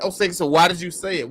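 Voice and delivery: ordinary talk, nothing marked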